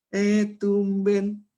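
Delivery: high, drawn-out fake surprise; mock surprise. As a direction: stretch out a sarcastic "eh, tumben". Acted greeting at study group.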